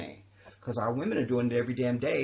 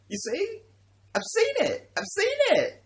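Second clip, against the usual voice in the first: much higher, half laughing, louder on the last two; squealed punchline; 5 dB louder than usual. Real, not acted. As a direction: squeak it out, cracking yourself up.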